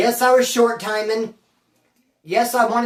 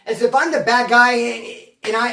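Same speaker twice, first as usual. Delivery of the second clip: normal talking voice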